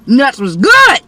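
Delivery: loud, high, happy shout; real yell about the nuts, then catching herself. Real, not acted. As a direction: blurt it out loud. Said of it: "Why I'm yelling at y'all?".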